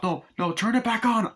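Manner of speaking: high, urgent acted plea; frantic demand. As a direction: play a caller panicking at the operator. Retold argument with car operator.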